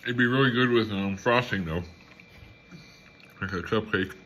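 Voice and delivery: eating a small pie; chewing while talking. Real, not acted. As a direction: mouth partly full. Seen 1: Bites pastry at -1, lips work closed mid-clip, pastry in hand.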